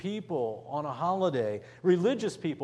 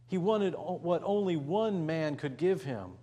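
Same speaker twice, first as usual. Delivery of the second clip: ordinary talk, nothing marked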